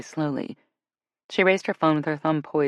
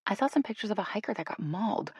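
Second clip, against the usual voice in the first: soft, higher-pitched worry; 5 semitones higher, 5 dB quieter than usual. A narrator's reading. Jill recalling bear pictures to Shay, soft. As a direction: confide it in a hush. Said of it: "said just as softly".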